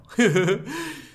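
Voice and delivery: sudden high loud laugh; loud burst of laughter. Real, not acted. Recalling the friend who threw him out, loud laugh. Laughs at his own quote.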